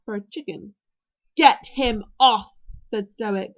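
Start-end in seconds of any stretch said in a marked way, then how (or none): 1.4-2.6 slow, forceful shout, word by word; loud command. A narrator's reading. Stoic with a dragon on his beard, commanding. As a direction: order it loudly, punching each word. Stoic attacked by Toothless, ordering Hiccup.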